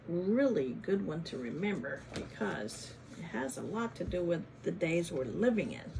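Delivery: plain reading voice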